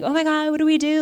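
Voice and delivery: imitating a frantic husband; panicked husband. Mother imitating husband during seizure, panicked high cry. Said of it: "he was like".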